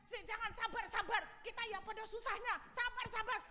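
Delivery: high, hurried mock pleading; urging patience. One man calming the angry mob, pleading.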